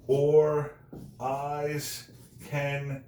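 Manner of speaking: each word held out alone, with long pauses between; syllable by syllable. Shown as a demonstration. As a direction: count out "So long as men can breathe" one slow, separate syllable at a time. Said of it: "Ten syllables".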